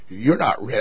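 low, soft rebuff; low and understated doubt; quiet refusal. Acted. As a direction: turn him down in a low, subdued voice. Preacher's first refusal in acted exchange.

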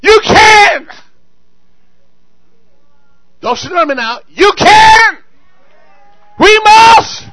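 high, loud declaration; preaching shout. Real, not acted. Pastor proclaiming God's word to the church, shouted high and loud. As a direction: hurl it out like a rallying call.

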